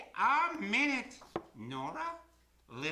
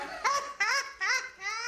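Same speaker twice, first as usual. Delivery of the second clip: big raucous belly laugh; hearty laugh; 12 semitones higher than usual. Acted. Laughs at own joke.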